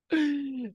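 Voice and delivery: high loud burst of nervous laughter. Real, not acted. Reader laughing at the card's sharpness, loud burst. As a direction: laugh off the shock. Laugh after harsh card.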